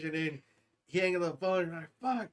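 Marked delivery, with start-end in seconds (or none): 2.0-2.3 startled outburst. Retold or imitated. Retold dad after phone call.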